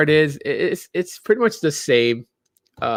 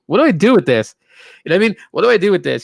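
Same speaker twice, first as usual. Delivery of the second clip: higher, louder, pleading what do I do; exasperated; 6 semitones higher, 6 dB louder, much faster than usual. Real, not acted. To the viewers about turning forty, mock despair. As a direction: throw up your hands in mock despair, louder.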